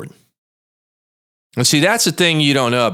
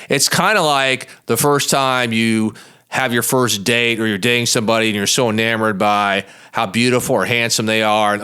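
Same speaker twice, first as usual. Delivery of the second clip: ordinary talk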